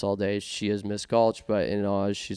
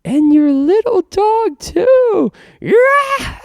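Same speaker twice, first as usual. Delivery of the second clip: shrieking, much higher and louder witch cackle-voice; Wicked Witch impression; 12 dB louder, much slower than usual. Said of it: "Wicked Witch of the West impression".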